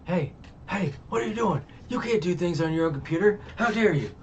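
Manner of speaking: high, loud playful scolding; mock outrage. Scolding someone busy on their own computer, mock outrage. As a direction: tell them off in pretend indignation.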